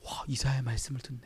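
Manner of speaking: hushed whisper of wonder; awed whisper. Acted. Philip overhearing the reading from afar, awed whisper. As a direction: breathe it out in amazement. Philip hears Isaiah read.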